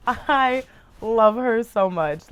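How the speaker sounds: slow, drawn-out declaration; sincere slow gush; heartfelt gushing. Real, not acted. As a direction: stretch it out with love. Slow emphatic repeat.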